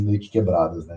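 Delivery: ordinary delivery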